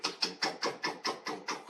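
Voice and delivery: soft, voiceless tuk-tuk chops; quiet chopper noise; imitated helicopter landing. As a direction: tap out rotor beats with the mouth.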